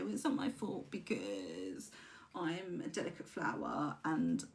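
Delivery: low, soft, slow self-pitying whimper; mock victim. Speaker voicing a self-pitying ego in victim mode. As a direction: play the poor delicate flower.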